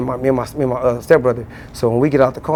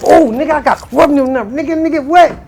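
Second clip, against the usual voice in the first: high, giddy disbelief; bursting with excitement; thrilled; 11 semitones higher than usual. Retold or imitated. Waking up remembering the number, high and giddy.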